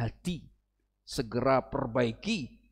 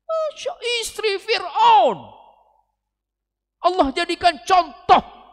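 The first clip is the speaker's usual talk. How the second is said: slow, higher, louder, choked with emotion; moved; 12 dB louder, slower than usual. Real, not acted. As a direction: let the voice catch with feeling. Terharu menyebut istri Fir'aun. (suara terharu, hampir menangis)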